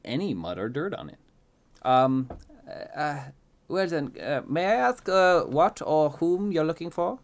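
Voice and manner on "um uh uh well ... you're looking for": higher, halting, fussy voice; hesitant fancy gentleman. A narrator's reading. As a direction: stammer politely, pitched up. Fancy man hesitantly questions child.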